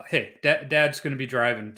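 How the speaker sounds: ordinary delivery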